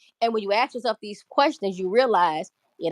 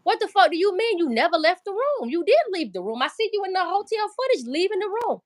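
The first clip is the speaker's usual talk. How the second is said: rising disbelief, pitch climbing higher; incredulous; 6 semitones higher than usual. Retold or imitated. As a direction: call out the lie, voice going up. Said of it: "And I said to myself".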